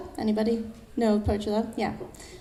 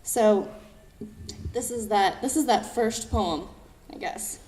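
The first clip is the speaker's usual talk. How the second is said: ordinary delivery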